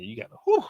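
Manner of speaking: high, breathy exhale of relief; whew. Real, not acted. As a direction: let it out like a long sigh. Exhausted sigh at the soak.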